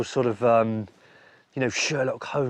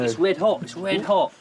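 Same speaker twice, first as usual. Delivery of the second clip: louder, higher excited exclaim; 7 semitones higher, 5 dB louder than usual. Real, not acted. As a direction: call out that it's hot. Hot potato out of kiln.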